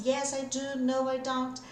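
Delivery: a hint of a rote recital; rote memorized answers. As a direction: lightly imitate reciting stock answers. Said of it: "respostas decoradas".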